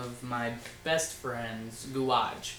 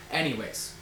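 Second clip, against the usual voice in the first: ordinary delivery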